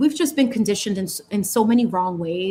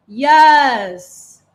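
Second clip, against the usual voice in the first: usual speaking voice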